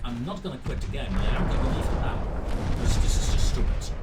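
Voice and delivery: fast, loud, done with it; fed up. Real, not acted. Gamer telling viewers he is quitting the match, fast and loud. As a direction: rush it out, fed up and leaving. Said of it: "Yeah, I'm annoyed".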